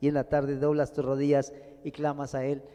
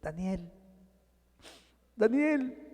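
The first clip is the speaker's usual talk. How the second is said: llama con tristeza, la segunda más alta y fuerte; llamada triste; 5 semitones higher, 3 dB quieter, slower than usual. A narrator's reading. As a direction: llamando a Daniel con pena. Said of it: "llamó a voces a Daniel con voz triste". (el rey llama triste a Daniel en el foso)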